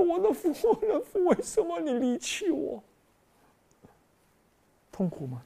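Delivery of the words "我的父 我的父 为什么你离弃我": anguished cry. Acted. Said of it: "他说".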